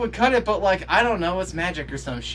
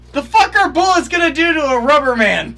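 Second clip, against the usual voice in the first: incredulous yell; 10 semitones higher, 10 dB louder than usual. Real, not acted. Viewer scoffing at bullets on a rubber man, incredulous yell. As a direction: yell the obvious answer at the screen.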